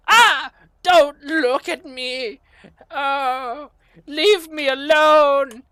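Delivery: high, loud squealing panic; a tiny creature shrieking to be left alone; frightened kobold. Acted. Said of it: "the kobold goes".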